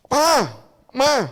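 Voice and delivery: loud call to Papa and Mama. Acted. Child calling out to parents, loud. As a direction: call the parents to get their attention.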